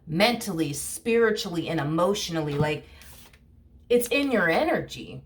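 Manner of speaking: normal talking voice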